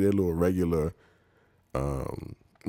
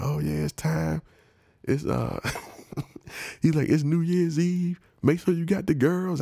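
playful hustler voice, much higher; mock hustler pitch; 11 semitones higher than usual. Hustler's New Year pitch.

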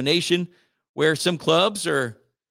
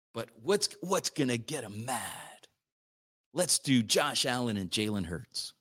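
much softer, lower plotting voice; conspiratorial hush; 7 semitones lower, 11 dB quieter than usual. Retold or imitated. Host plays editors scheming to anger fans, hushed. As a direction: lean in and scheme softly.

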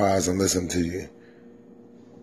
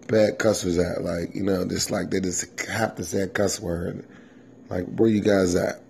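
normal talking voice

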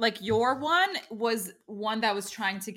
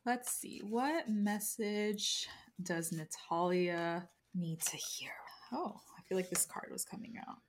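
whispering; 3 semitones lower, 10 dB quieter than usual. Real, not acted. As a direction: whisper the question to the cards. Pulling a tarot card.

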